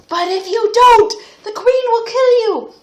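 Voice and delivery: high, loud, fast alarmed princess; panicked protest. Acted. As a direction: plead in a hammed-up panic.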